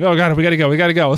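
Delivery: mock panic, high and hurried; frantic. Thirty players fleeing the maze, acted frantic urgency. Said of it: "this big frantic, like, event".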